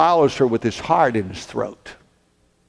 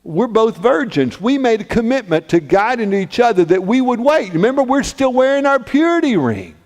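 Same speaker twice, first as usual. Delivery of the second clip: higher-pitched, insistent objection; incredulous protest; 7 semitones higher than usual. Acted. Joseph protests in disbelief.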